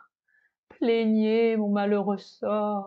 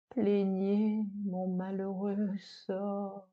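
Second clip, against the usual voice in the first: a hushed, sorrowful plea, softer; soft lament; 2 semitones lower, 7 dB quieter than usual. Acted. The spurned dish begs the audience, softly mournful. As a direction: beg the listeners quietly for pity.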